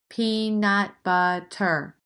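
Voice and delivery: peanut butter slowed, jaw dropping on each beat; slow syllable count. Shown as a demonstration. Teacher slowly counts chin drops in peanut butter. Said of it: "how many times their chin goes down".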